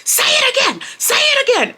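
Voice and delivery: very high-pitched confrontational yell; screaming. Retold or imitated. Girl screaming at Brian after he insults her, challenging. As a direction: scream it as a furious dare. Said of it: "So I start screaming".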